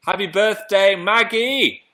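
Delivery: high, loud happy birthday call; excited birthday shout-out. Real, not acted. Wishing viewer Maggie happy birthday, excited call. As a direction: cheer the name out to her.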